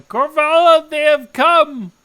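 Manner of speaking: high, full-voiced alarmed yell. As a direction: cry out a warning to the whole house. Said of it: "she keeps yelling".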